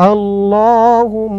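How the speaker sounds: slow, drawn-out chant of আল্লাহুম; slow recitation. Shown as a demonstration. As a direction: draw the word out melodically. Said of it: "ভেঙে ভেঙে বলবো". (ধীরে টেনে সুরে পড়া)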